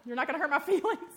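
shouted high and loud, playful; pretend audience call. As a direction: call out like a listener who can't keep up. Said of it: "somebody raise your hand and say".

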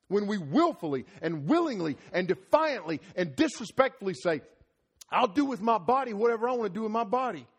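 high, loud preaching; loud, high pulpit preaching; full-force preaching. Real, not acted. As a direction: preach it hard to the congregation.

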